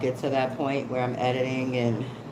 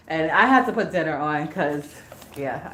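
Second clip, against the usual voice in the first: ordinary talk, nothing marked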